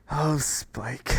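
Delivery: usual speaking voice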